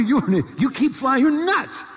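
high, laughing ridicule; laughing disbelief. Retold or imitated. Telling Dorn he is nuts, laughing high. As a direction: laugh him off as crazy. Mocking the crash-happy owner.

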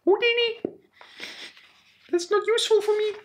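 very high-pitched squeaky little alien; different alien voice. Acted. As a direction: put on a tiny, piping falsetto alien. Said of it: "Maybe I should do a different one".

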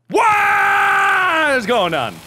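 very high, long-held "What"; bewildered yell. Real, not acted. Player lost in the woods, bewildered yell. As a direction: stretch "What" into a wail.

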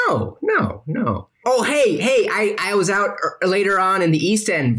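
high, cheerful character voice; bright silly host chatter; high goofy voice. Acted. The host rambles to the vampires about his night out, in a high goofy voice.

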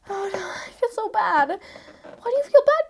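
normal talking voice

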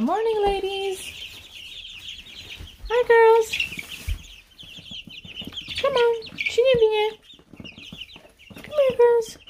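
high sing-song coo to chicks; high cooing pet voice. Real, not acted. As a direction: coo high and sweet like to babies.

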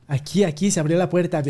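ordinary talk

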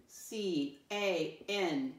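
very slow, drawn-out letters; spelling aloud. Shown as a demonstration. Teacher spells a word with pupils, very slow spelling aloud. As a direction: stretch out each letter for pupils.

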